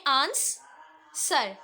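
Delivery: Answer drawn out very slowly, split into its two syllables; slow teaching demo of answer in two beats. Shown as a demonstration. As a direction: stretch answer to show each syllable. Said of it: "दो आवाजें".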